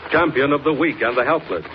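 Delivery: ordinary talk, nothing marked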